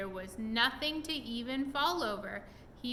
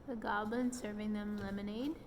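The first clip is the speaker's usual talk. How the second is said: plain reading voice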